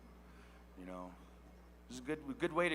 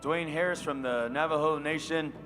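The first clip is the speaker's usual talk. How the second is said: louder arena announcer introducing a dancer; powwow emcee; 3 semitones higher, 4 dB louder than usual. Real, not acted. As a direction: announce him proudly over the PA. Introducing the head gourd dancer.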